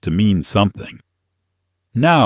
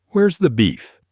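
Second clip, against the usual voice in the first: higher, indignant fed-up grandmother; annoyed old lady; 9 semitones higher than usual. Retold or imitated. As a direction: accuse like a grandma with no time for nonsense. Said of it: "with a thick Chicago accent and a face that looked like it had seen too much nonsense".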